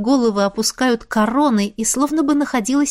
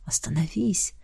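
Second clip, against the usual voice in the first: hushed plea; 4 semitones lower, 8 dB quieter than usual. A narrator's reading. Ant breathless.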